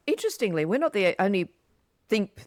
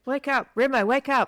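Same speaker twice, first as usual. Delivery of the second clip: louder, higher playful call; calling to wake; 7 semitones higher, 4 dB louder than usual. Real, not acted. Host teasing her drowsy co-host Remo, loud playful call. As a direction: rouse him with a teasing shout.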